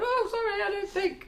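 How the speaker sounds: very high-pitched mock apology; high little voice, playing innocent; sheepish apology. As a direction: squeak out a comic excuse. Retold own sheepish apology.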